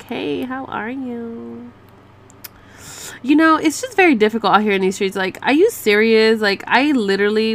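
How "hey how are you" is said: slow sing-song hey; drawn-out mock greeting. Typing a reply to a guy, slow sing-song mock greeting. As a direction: stretch it out like a cheesy opener. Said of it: "let me write you real quick".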